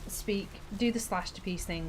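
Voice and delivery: ordinary talk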